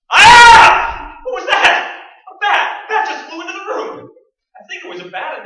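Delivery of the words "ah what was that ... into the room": startled high shout at a bat; loud frightened yell. Acted. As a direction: cry out in fright as it swoops. Bat flies into room.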